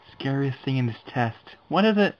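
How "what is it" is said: high, loud frightened question; scared high cry. Real, not acted. Scariest thing in test.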